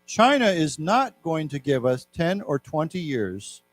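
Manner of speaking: careful, slow reading of a news quote; measured classroom reading; slow read-aloud. Shown as a demonstration. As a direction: read it out slowly for learners.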